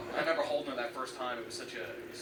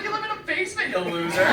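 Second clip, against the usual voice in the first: high-pitched taunt rising into a jeer; mocking sister; 13 semitones higher, 7 dB louder than usual. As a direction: sneer it like a big sister. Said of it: "She's like".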